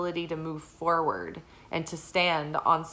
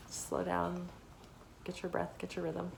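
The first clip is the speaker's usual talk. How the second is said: calming; 12 dB quieter than usual. Shown as a demonstration. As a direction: ease them back into their rhythm, very softly. Calming advice to catch breath.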